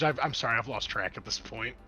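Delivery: ordinary delivery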